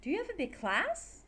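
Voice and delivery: "class" swept up high; yes-no question climbing to "class"; rising intonation. Shown as a demonstration. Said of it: "Goes up".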